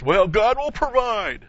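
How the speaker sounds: high, loud, drawn-out stock church saying; glib pious platitude. Retold or imitated. Preacher mimicking what people throw out all the time, glib and pious. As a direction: intone it slowly up high like a pat answer. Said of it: "You hear people throw that out".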